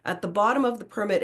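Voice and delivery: ordinary talk, nothing marked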